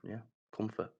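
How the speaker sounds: ordinary talk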